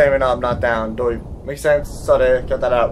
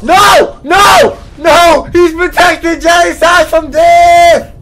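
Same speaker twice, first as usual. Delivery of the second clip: high, loud repeated screams of protest; outraged scream; 10 semitones higher, 7 dB louder, slower than usual. Real, not acted. Player seeing Johnny Sash protected, outraged screaming. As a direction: scream each NO, then howl the rest.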